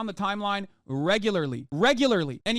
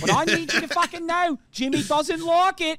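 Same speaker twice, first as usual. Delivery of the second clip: put-on Australian accent. Imitating a YouTuber on scary music, mock Australian accent. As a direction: build into a hammed-up Aussie voice. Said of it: "that is not a bad Australian accent".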